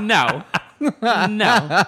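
loud, high no through laughter; scandalized, laughing objection; laughing refusal. Retold or imitated. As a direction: protest while cracking up. Retells her refusal, laughing.

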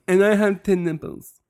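high, mushy pet voice; Izelle. Acted. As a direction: speak as a deaf princess dog, muddling the consonants. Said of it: "she talks like this".

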